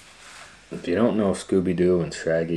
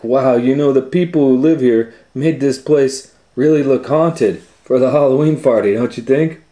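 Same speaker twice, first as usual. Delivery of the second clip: higher, louder, excited Shaggy; 7 semitones higher, 10 dB louder than usual. Acted. Shaggy admires the haunted house, high and loud. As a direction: rush it like an awed Shaggy. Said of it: "Shaggy laughed".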